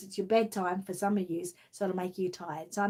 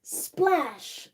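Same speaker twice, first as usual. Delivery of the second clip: high, louder splash word; big splash; 8 semitones higher, 3 dB louder than usual. Acted. Reading the puddle landing to children, lively splash. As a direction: burst out with it for the kids.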